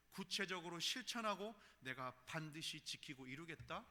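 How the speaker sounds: normal talking voice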